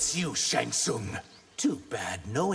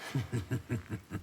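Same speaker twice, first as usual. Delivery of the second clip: soft, low menacing laugh; quiet villain chuckle; low taunting chuckle; 5 semitones lower, 8 dB quieter than usual. Acted. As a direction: chuckle darkly at the challenge. Fighter taunting before combat.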